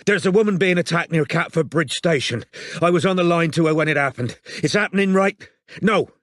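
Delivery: panicked emergency call; urgent. Acted. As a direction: rush the report out in alarm.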